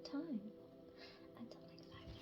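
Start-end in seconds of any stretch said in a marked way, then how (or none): 1.4-2.1 breathy whispered sign-off. Real, not acted. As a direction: whisper it softly as a goodbye. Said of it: "I felt like whispering at the end".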